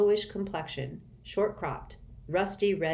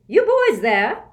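high, loud, slow snap; snapping; 8 semitones higher, 12 dB louder, slower than usual. A narrator's reading. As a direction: snap at the boys. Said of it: "snapped the pencil woman".

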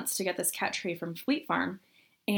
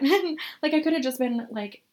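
ordinary delivery

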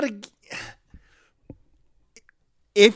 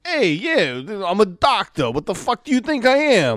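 higher-pitched New York tough-guy patter; Andrew Dice Clay; 4 semitones higher than usual. Acted. Dad stuck in his kid's body, mock New York wise-guy voice. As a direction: play it as a cocky wise guy. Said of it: "I love Andrew Dice Moore".

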